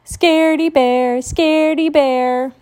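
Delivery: high, taunting chant; mock scaredy-bear chant, slow on the repeat; teasing singsong. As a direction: tease her in a playground singsong. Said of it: "teased Brother".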